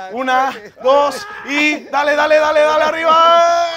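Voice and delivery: grito sostenido, agudo y fuerte; arenga a gritos. Real, not acted. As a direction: anima a levantar el peso gritando. Anima a levantar la carga. (arenga de esfuerzo real)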